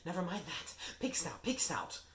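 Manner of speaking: panting. Acted. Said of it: "panted Harry".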